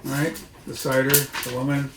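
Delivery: usual speaking voice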